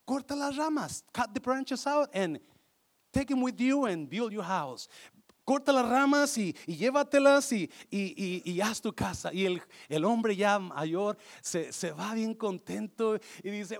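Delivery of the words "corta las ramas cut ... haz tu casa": higher, louder, eager offer; eager giving tree. Acted. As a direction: give everything gladly. El árbol ofrece sus ramas. (árbol generoso y entusiasta)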